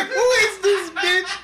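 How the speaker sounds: high-pitched incredulous question; mock outrage with a laugh; retold bewilderment. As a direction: ask it baffled, breaking into laughter. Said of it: "I was like".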